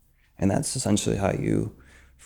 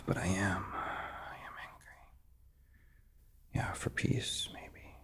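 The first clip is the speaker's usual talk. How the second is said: sighing whisper of what you want; soft sighing whisper to yourself; whispered inner voice; 14 dB quieter than usual. Shown as a demonstration. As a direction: breathe it out, barely voiced. Demo of admitting anger, whispered.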